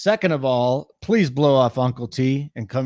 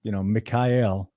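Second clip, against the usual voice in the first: imitating soothing talk; 4 semitones lower than usual. Girlfriend introducing her boyfriend to viewers, imitated soothingly. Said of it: "she talks to you soothingly".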